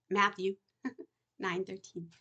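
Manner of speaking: usual speaking voice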